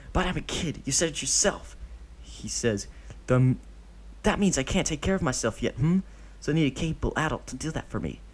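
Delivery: put-on high boyish voice, quick at the end; a kid winning the argument; clever child. Deidara twisting Obito's words, high quick child voice.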